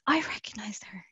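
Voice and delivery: hushed whisper to herself. Retold or imitated. Spotting Noelle Foley in the hallway, an excited whisper. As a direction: breathe it like a secret discovery.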